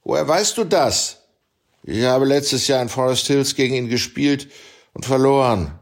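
a hint of a sure young tennis pro; voiced tennis player Abel. A narrator's reading. Abel's voice in the story.

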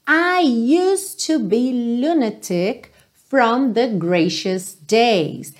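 sung lyric said slowly, word by word; slow pronunciation demo. Shown as a demonstration. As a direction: spell out each word slowly for the learner. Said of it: "Então, começando". (demonstração lenta da letra)

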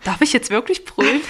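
imitating a hesitant child; a hint of an unsure kid asking; child asking timidly.

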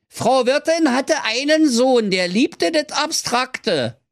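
put-on very high, slow child recital; piping kid reciting a rhyme; Hänsel voice. A narrator's reading.

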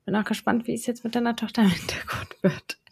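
hushed whisper so she stays asleep; whispering over a crib. Real, not acted. Child sleeping in background.

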